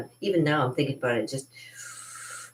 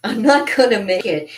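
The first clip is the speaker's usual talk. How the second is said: laughing through the line, louder and higher; laughing repeat; 5 semitones higher, 8 dB louder than usual. Retold or imitated. Recalling her near drowning, she laughs the plea again.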